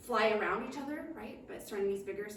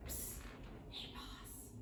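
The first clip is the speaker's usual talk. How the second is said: secret whispered aside; 18 dB quieter, much slower than usual. Acted. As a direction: whisper so the dragonfly can't hear. Serenity secretly aside to King.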